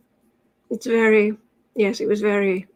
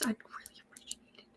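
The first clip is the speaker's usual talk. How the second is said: whispered aside; 20 dB quieter than usual. Real, not acted. Aside to chat.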